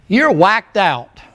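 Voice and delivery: ordinary talk, nothing marked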